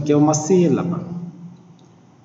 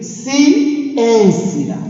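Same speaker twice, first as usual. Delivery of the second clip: "Ciência" in slow pieces, ÊN pitched up and forceful; stressed syllable; 5 semitones higher than usual. Shown as a demonstration. Teacher repeating "Ciência" for the class, ÊN stressed. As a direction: repeat "Ciência" split up, with weight on ÊN. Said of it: "a sílaba que eu pronunciei com mais força".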